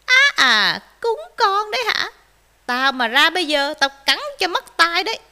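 loud threatening shout; calling out from inside. A narrator's reading. Fox inside threatens dogs, calling out loudly. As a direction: shout a menacing warning outward. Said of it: "nói vọng ra".

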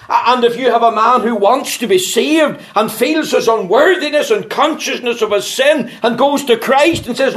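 high, loud, ringing pulpit voice; fervent preaching. Acted. Preacher urging the congregation, full-force preaching. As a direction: preach it to the rafters.

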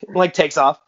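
ordinary talk, nothing marked

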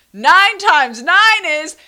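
loud, slow yelled sum; yelling. Retold or imitated. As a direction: shout it at a passerby. Child yelling quote at stranger.

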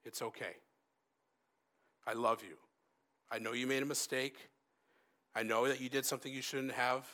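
gently acted parental comfort. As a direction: soothe a child who messed up. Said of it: "that parent just leans over and goes".

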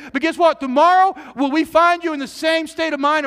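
loud, high, rising preaching build; full-force preaching. Acted. Pastor rousing the congregation, loud preaching. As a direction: drive it home to the church.

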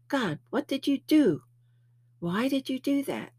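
high, exasperated self-scolding; self-reproach. Retold or imitated. Berating himself over eating meat, high and exasperated.

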